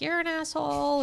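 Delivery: high, softened retold yell; mock yell. Friend recaps the wedding fight, mock yell in a high voice. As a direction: imitate her yelling without the volume. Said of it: "yells at him".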